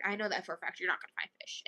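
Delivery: whispered, voiceless retort. Retold or imitated. Retelling her friend whispering a smug retort. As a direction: hush it like a secret.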